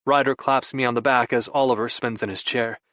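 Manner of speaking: usual speaking voice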